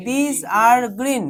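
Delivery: drawn out slowly word by word; slow dictation to students. Shown as a demonstration. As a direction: read it out slowly for the class to write. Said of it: "teacher dictating slowly".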